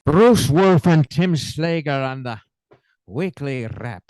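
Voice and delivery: slow sign-off in a put-on Irish accent. Shown as a demonstration. Host reads the show ID as the Lucky Charms leprechaun, Irish accent. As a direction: channel a cheeky leprechaun, Irish lilt.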